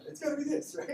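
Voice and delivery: jumps way up high, playful mock voice; a hammed-up high-pitched patient; mock squeaky patient voice. Mock patient voice in retold exchange.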